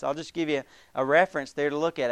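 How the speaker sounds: ordinary delivery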